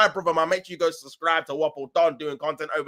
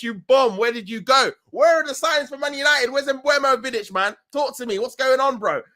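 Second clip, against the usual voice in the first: high-pitched, pleading demand; worked-up, raised-voice banter; mock indignant rant; 7 semitones higher, 3 dB louder than usual. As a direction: call out a mate who went missing. Mock rant at missing chat member.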